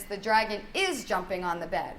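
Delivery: IS picked out in "dragon is jumping"; stress on IS. Shown as a demonstration. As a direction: lean on IS as the corrected word. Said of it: "use your tone and your intonation".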